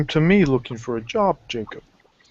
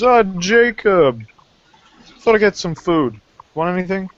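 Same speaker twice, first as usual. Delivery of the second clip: Maroney greeting; 6 semitones higher, 3 dB louder than usual. Acted. GM voices Maroney greeting Jacob.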